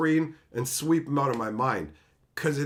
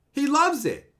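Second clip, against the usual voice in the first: normal talking voice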